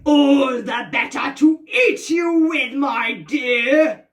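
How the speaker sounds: loud, slow, menacing wolf shout; wolf's roar. Acted. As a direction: bellow it out as the hungry wolf. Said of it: "And the wolf says".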